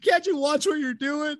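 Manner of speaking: very high mock-outraged yell; comic high-pitched outrage; screaming at her. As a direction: scold her in a hammed-up scream. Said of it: "Just start fucking screaming at her".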